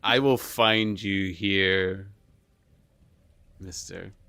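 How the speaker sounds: slow, sinister threat; a hint of a movie villain; menacing villain voice.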